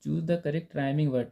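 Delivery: reading the instruction. Shown as a demonstration. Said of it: "यहाँ हमें तुकबंदी वाले".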